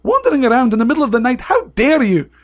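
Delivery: high, loud, indignant scolding; acted outraged teacher's tirade; shouted Professor McGonagall. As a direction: tear into a pupil caught out of bed. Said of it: "she shouted".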